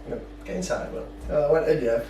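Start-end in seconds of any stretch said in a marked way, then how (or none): 0.4-1.1 hushed, contained telling-off; retold stern order. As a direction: order him indoors quietly. Acts out dad's quiet order.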